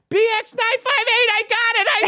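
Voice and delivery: shrill yell of the plate number. Retold or imitated. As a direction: shout it out, triumphant. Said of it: "I hear her yell out".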